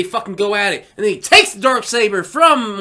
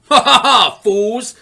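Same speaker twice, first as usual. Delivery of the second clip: villain laugh; 4 semitones higher, 5 dB louder, slower than usual. Retold or imitated. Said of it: "Moff Gideon's like".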